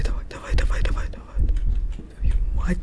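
tense unvoiced whisper urging on; hushed frantic whisper. Real, not acted. Hiding from the monster.

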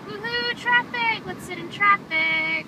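high mock-cheerful cheer; sarcastic cheering. Mock cheering about traffic.

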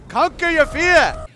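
slow, high rallying cry; mock heroic battle cry. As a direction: rouse the troops. Rallying the monkeys.

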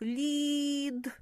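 Лід with a long, high, level І; middle sound stretched. Shown as a demonstration. Teacher helping children hear the next sound, І stretched. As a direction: hold the І in Лід. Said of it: "Який далі чуємо звук".

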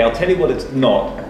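ordinary talk, nothing marked

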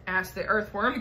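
usual speaking voice

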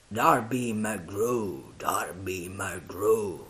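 very slow ghostly moan, sinking; feeble wailing call. A narrator's reading. Unseen voice calling again and again, mournful moan. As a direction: stretch each name into a moan. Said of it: "gemeva la voce".

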